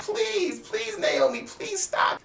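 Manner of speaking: high, soft, breathy begging; imitating a tearful plea, half-whispered; mock sobbing plea. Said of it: "she's a great actor".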